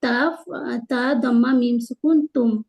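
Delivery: ordinary talk, nothing marked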